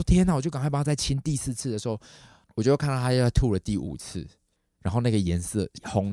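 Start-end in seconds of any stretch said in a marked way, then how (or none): 0.0-0.3 high, loud cry of alarm; startled. Retold or imitated. Owner sees blood in dog's vomit, startled. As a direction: blurt it out in sudden fright. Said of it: "我就吓到了".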